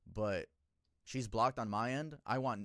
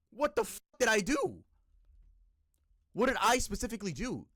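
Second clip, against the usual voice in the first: high, loud disbelief; demanding to know what he did; exasperated outburst; 9 semitones higher, 7 dB louder than usual. Real, not acted. Venting about the teacher's attack.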